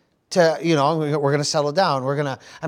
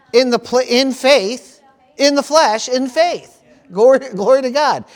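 climbing up and louder, lingering on each phrase; rising preacher fervor; 6 semitones higher, 4 dB louder than usual. Real, not acted. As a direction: build the sermon to its peak. Preacher building to a climax.

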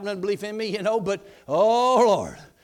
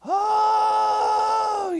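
high loud drawn-out wailing "Oh"; squalling wail; 14 semitones higher, 6 dB louder than usual. Retold or imitated. Mother squalling over the phone, wailing. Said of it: "So she was squalling".

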